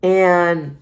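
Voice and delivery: ordinary delivery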